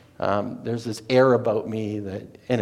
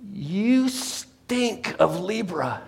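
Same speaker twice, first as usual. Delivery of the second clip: slow, drawn-out higher mystic voice; astrologer's pronouncement; 5 semitones higher, 3 dB quieter, much slower than usual. Retold or imitated. Astrologer judging the waiter at her table, slow and drawn-out. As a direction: pronounce it like a knowing seer, very slowly.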